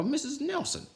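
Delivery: very high startled call; surprised. Acted. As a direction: gasp her name in surprise. Blansworth surprised.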